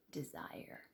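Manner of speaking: low, hushed, lingering on the word; imitating the therapist, soft and low; therapist's changed tone. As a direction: drop to a near-whisper for the loaded word. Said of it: "his tone seemed to change".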